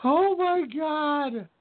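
laughing disbelief. Real, not acted. Cracking up at Fury quote.

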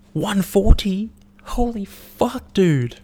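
high, slow, drawn-out holy fuck; reacting to the guy's heart rate, stunned disbelief. Real, not acted.

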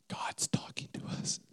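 whispered aside, unvoiced and hushed; whispering to his wife during the sermon. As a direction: whisper it to your wife without turning your head. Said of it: "we look at the corner of our eye like".

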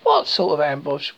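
usual speaking voice

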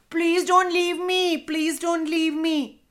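high, urgent little begging voice; high-pitched pleading, repeated insistently; pleading little character. A narrator's reading. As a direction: beg in a high voice not to be left behind. Left-behind toy begs Cammy.

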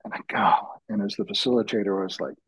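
ordinary talk, nothing marked

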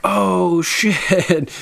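oh shit. Retold or imitated. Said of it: "And I'm like".

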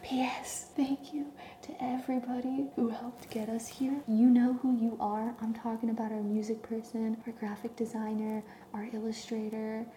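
breathy whisper right in the ear. Real, not acted. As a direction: whisper close to the mic, like a secret. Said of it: "do you hear us whispering in your ear".

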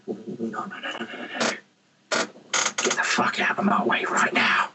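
menacing whisper, all breath; whispered threat. Acted. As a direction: warn them off in a fierce whisper. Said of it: "or I'm like".

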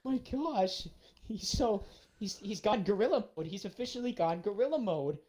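like you can't believe what you're seeing; stunned disbelief. Retold or imitated. Incredulous quote about the man.

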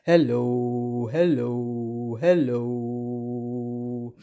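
very deep, drawn-out hello; low, slow-held greeting; as low as possible. Shown as a demonstration. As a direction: drop to the bottom of your range. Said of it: "as low as we can".

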